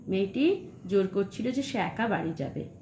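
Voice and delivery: ordinary talk, nothing marked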